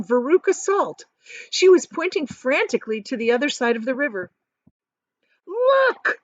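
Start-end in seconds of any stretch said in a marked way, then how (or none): 5.5-6.2 high, louder excited scream. A narrator's reading. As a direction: shriek and point across the river. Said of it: "she screamed".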